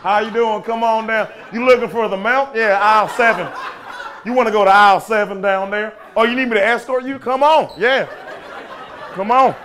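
high, loud friendly store clerk; hammed-up Southern store greeter; overeager American greeter. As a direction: play a pushy welcoming salesman. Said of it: "they were like".

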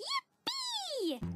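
high squeal of joy; excited cheer. Real, not acted. Girl cheers about being tucked in, high joyful squeal. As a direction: squeal it with delight.

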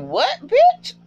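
high-pitched incredulous outburst; indignant, high-pitched double take; shocked disbelief. Retold or imitated. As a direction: react aghast, voice shooting way up. Retold shocked reaction to torture.

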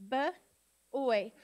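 B-oy broken into two sounds, b, then oy; sounded out. Shown as a demonstration. Teacher sounding out a word with students, B-oy split into sounds. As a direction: give B-oy as separate sounds with a pause. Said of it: "sound it out with me".

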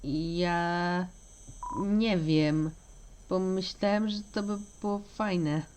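soft, halting admission; low-key, timid answer with pauses; sheepish hesitation. Acted. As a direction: trail along quietly, unsure of yourself. Unsure, sheepish answer when questioned.

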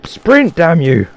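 high, loud yell at the game; yelling at a controller that won't listen; frustrated shout. Real, not acted.

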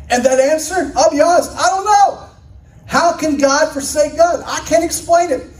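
high-pitched, impassioned admission; emphatic bafflement. Real, not acted. Preacher honestly admitting to congregation he doesn't know, high emphatic bafflement. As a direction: throw it out high, openly stumped.

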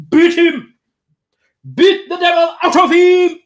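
yelled high and loud, beat the devil out; a father barking orders at the priest; loud, high mock-furious command; furious dad shout.

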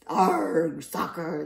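loud answering cry; crew yell. Acted. Crew echoing their captain, loud yell. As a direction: shout it back as a crew. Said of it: "the crew yelled".

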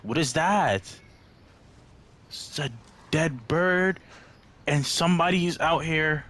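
spooked by dead birds, voice climbing; frightened. Real, not acted. Horror game scares the player.

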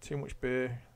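normal talking voice

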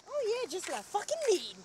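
exaggerated sarcasm, high and loud; sarcastic. Shown as a demonstration.

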